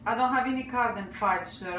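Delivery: ordinary delivery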